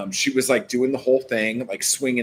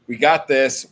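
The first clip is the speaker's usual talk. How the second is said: pep talk; 4 semitones higher, 6 dB louder than usual. Real, not acted. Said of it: "pep talk to self".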